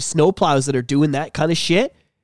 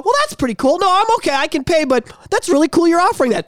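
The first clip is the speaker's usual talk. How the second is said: mock polite refusal; 9 semitones higher than usual.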